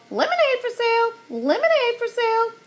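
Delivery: high, fast squawking parrot voice. A narrator's reading. Parrot Petey squawking to passersby, high and shrill. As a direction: squawk it out like a parrot hawking lemonade.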